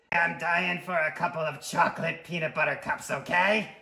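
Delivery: loud, pushy character voice; exasperated craving. Acted. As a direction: demand it like an impatient sweet tooth.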